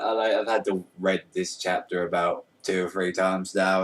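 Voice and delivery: ordinary talk, nothing marked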